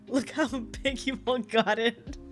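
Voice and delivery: normal talking voice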